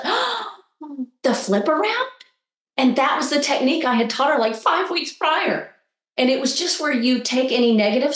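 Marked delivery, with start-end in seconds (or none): 0.0-2.1 sudden realization. Retold or imitated. Mother realises, gasps.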